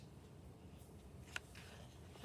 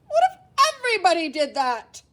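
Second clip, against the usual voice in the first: mildly stern cake lady, a bit louder; lady scolding; 6 semitones higher, 5 dB louder than usual. Acted. Lady at the wedding cake table glaring, mild stern rebuke. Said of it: "glared at me over her glasses and said".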